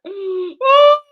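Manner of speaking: high mock sob, loud then thin; broken-down wailing. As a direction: wail the apology as if in tears. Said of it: "it broke me".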